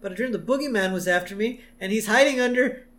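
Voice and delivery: Lisa. Retold or imitated. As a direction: play a worried kid explaining a dream. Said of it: "She says".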